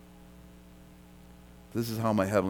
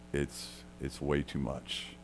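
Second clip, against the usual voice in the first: softer and slow, letting it sink in; hushed, conceding the point; quiet agreement; 6 semitones lower, 10 dB quieter, slower than usual. Real, not acted. Preacher agreeing with the doubting crowd, hushed and slow.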